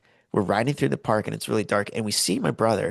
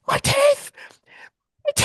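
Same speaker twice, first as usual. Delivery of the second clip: high, loud pained scream; imitated scream; 4 dB louder than usual. Hurt friend screaming after a golf ball to the face. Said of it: "I've never heard a person scream like this".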